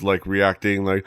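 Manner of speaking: usual speaking voice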